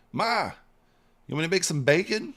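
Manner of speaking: high, quick, loud teasing offer; playful needling. Retold or imitated. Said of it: "just trying to give her a hard time".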